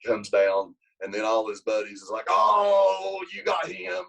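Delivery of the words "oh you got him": high, loud taunting whoop; teasing shout. Retold or imitated. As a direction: jeer at him like a rowdy teammate. Buddies taunting the scared kid.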